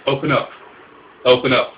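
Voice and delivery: screaming at a door. Acted. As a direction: yell for them to open up.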